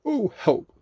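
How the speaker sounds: high, loud cry for help; alarmed Pooh. A narrator's reading. Pooh finding himself stuck in the hole, alarmed.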